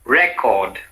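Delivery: "record" as REK-ord; strong REC, falling through record; stress on first syllable. Shown as a demonstration. Teacher models the noun for repetition, record stressed on REC. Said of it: "said in two different ways".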